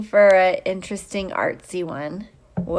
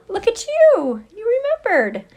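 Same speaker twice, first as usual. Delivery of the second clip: much higher, louder delighted cry; excited greeting; 12 semitones higher, 4 dB louder than usual. Real, not acted. Teacher greeting student Kaylee on stream, excited and high. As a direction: gush over the student who showed up.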